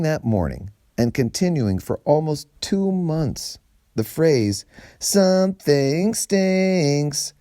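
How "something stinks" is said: taunting sneer. Acted. The taunt quoted again.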